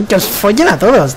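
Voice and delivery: loud, high, exasperated curse; frustrated shout. Real, not acted. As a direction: yell it at the whole team. Cursing teammates in frustration.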